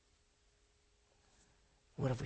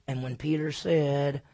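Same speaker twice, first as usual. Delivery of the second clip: usual speaking voice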